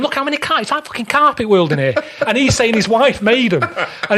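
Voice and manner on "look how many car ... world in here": incredulous outburst. Retold or imitated. Retold outburst about carpets.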